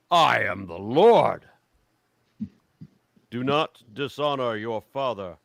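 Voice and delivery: loud, slow divine proclamation; booming commandment from God; solemn scripture reading. Acted. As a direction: declare it as the Lord, hammering the key words. Reads God's commandments.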